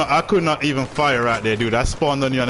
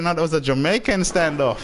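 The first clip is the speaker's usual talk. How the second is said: ordinary talk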